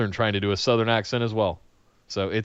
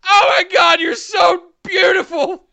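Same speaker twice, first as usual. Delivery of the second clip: starstruck gushing. Retold or imitated. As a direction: wail it slowly like an overwhelmed fan, half laughing. Retelling own gushing at his idol.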